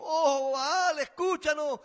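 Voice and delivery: slow, loud mock plea to an idol; loud, drawn-out mock cry to Baal. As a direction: cry it out slowly like the prophets of Baal. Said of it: "Todo el tiempo gritaban lo mismo".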